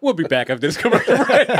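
laughing. Real, not acted. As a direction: get the sign-off out through laughter. Jokes while cracking up.

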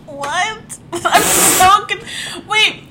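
high and slow through laughter; stunned laughing reaction to the story; laughing disbelief. Real, not acted. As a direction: laugh out your confusion.

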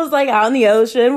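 usual speaking voice

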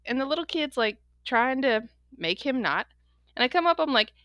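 ordinary talk

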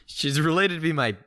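high, helpless laughter through the words; too tickled to talk, pitch climbing; flustered laughing. Real, not acted. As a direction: try to get it out and crack up. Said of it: "you can't even talk right now. You're so flustered".

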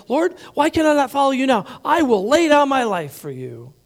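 insistent pledge of loyalty; protesting Peter. Retold or imitated. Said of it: "And Peter said to him".

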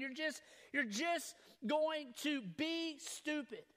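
drawn out word by word; slow, damning verdict. Retold or imitated. The enemy telling him his lie, slow and deliberate. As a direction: deliver it slowly as a final judgment.

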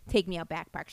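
usual speaking voice

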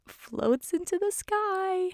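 slow, high, choked-up delivery; fighting back tears, pitch rising sharply; real tearful high voice. Real, not acted. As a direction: voice climbing as tears come. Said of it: "my voice starts to get a lot higher".

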